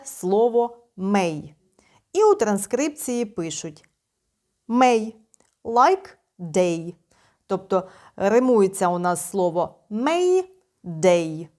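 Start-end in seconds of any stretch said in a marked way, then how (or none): none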